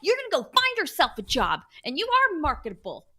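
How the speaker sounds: high, loud rallying pep talk. Pretend. Host rallying listeners to ask for a raise, pep talk. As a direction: fire them up with emphatic, high-pitched urging. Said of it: "turning into, like, a pep talk".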